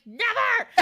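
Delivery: high defiant shout of refusal; defiant cry. Retold or imitated. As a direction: fling the word back at him. Princess refuses the villain.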